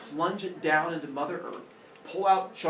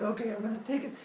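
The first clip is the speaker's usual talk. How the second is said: higher, hesitant voice; Father Sky; 7 semitones higher, 3 dB quieter, much faster than usual. Acted. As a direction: brace yourself for a reluctant peek. Reluctant father about to peek.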